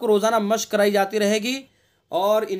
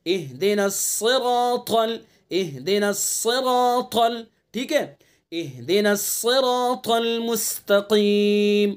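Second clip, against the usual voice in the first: slow melodic tajweed chant; Quran recitation; much slower than usual. Shown as a demonstration. Teacher reciting the verse slowly for students. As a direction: draw each syllable out in recitation.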